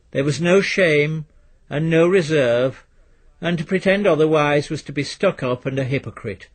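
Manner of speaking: ordinary delivery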